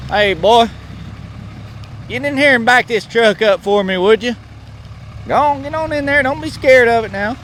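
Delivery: put-on country boss ordering in a Southern accent; Southern good-old-boy telling someone what to do.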